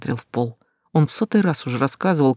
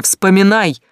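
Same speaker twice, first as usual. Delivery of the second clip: loud, high shout at Mark; 6 semitones higher, 6 dB louder, slower than usual. Acted. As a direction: yell at him to move. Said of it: "закричал он". (кричит на Марка)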